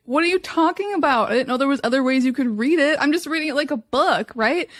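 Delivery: mock-bewildered protest; incredulous. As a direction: play dumb and amazed. Retold confusion about reading Bible.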